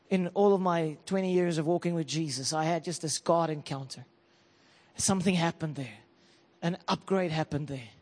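soft, low recollection; hushed testimony. Real, not acted. Preacher recalling a first serious God encounter, hushed. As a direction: drop low and soft, almost confiding.

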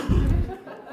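short sheepish laugh; embarrassed laugh. Real, not acted. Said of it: "I'm trying to justify myself".

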